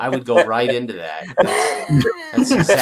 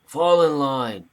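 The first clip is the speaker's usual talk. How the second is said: raised, higher call to the troops; backing up an order; 6 semitones higher, 3 dB louder than usual. Acted. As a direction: echo the leader's command, drawing out the last words. Acting out backing up Merrick's order.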